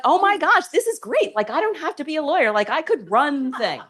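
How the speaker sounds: light up at the discovery; thrilled realization. Retold or imitated. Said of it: "and I thought".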